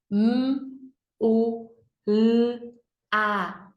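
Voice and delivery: M, U, L, A as "mmm, u, lll, a"; letter sounds. Shown as a demonstration. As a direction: sound out each letter separately, consonants held. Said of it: "fazer o sonzinho das letras também". (sonzinho de cada letra: "mmm, u, lll, a")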